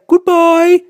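high, loud farewell; high-pitched bird call; owl character. A narrator's reading. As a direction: call goodbye as a departing owl. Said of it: "he said".

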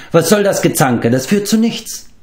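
high-pitched exclaimed rebuke, not shouted; calling out. A narrator's reading. Flavdur separates the quarrelling pair, calling out. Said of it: "rief er".

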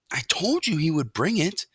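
put-on high, gloating young mouse; boasting. A narrator's reading. Young mouse proven right to his mother, boastful. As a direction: crow a little in a youngster's voice. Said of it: "could not help boasting".

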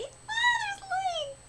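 high, soft gasping squeal; delighted squeal. Real, not acted. Fan spotting a favourite character, delighted. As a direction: coo in delight.

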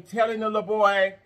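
ordinary talk

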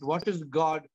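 ordinary talk

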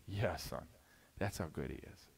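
dad answering his son, soft and low; quiet fatherly reply. Acted. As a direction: gently assure the boy.

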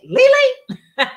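high questioning squeal of a name; retold squeal, breaking into a laugh; surprised recognition. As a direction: call out a friend's name in amazed recognition. Recognizes friend back, then laughs.

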